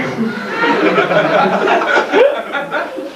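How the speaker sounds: long high laugh. Real, not acted. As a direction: break up laughing. Laughs at bookstore story.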